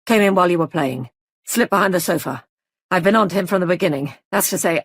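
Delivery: brisk fast rapid-fire report; short explosive sentences. Acted. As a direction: rattle it off in quick bursts. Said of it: "spoke in short, explosive sentences".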